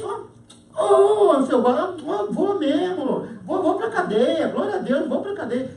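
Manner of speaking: high-pitched mock-joyful compliance; acting out a cheerful, willing arrest; eager surrender. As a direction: give yourself up gladly, with drawn-out high words. Acted cheerful surrender to the policeman.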